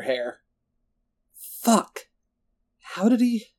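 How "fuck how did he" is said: high, broken-off distressed questions; curse, then grope for the question. A narrator's reading. Lindsay learns Roland took Will, distressed.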